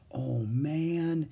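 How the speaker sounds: ordinary delivery, nothing marked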